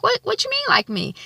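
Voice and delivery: high, startled question; alarmed Issa. Retold or imitated. Girlfriend startled by boyfriend's confession, shocked. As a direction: catch the shock in it.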